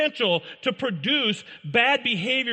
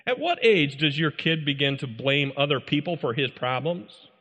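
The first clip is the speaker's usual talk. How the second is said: ordinary talk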